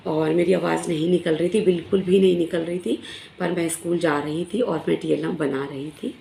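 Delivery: ordinary talk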